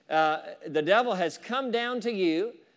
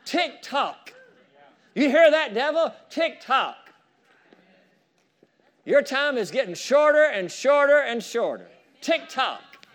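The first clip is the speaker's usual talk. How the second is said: high, mocking tick tock with a laugh; gleeful sing-song taunt; taunting the devil; 6 semitones higher, 4 dB louder than usual. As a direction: taunt him that his time is running out. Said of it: "just answer him back like this and say".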